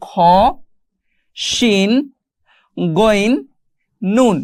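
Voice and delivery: scattered letter names read singly; careful, spaced-out alphabet drill; letter-by-letter demonstration. Shown as a demonstration. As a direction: model each letter with a pause after it. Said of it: "আলিফের পরে পড়ব".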